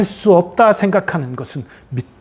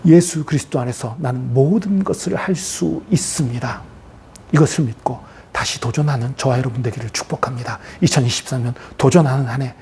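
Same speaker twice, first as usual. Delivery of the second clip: low, hushed affirmation after a climax; solemn blessing; 4 semitones lower, 4 dB quieter than usual. Real, not acted. Preacher blessing the congregation for a new challenge, solemn blessing. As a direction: settle into a measured, earnest benediction.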